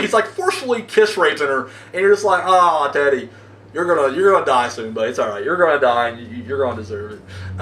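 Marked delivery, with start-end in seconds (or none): 2.5-7.6 taunting. Retold or imitated. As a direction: croon it like a smug narrator. Taunting Teddy in low voice.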